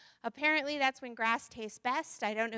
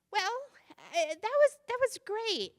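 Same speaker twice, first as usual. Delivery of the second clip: awkward polite pig; 8 semitones higher than usual. Acted. Pig's polite hesitant praise.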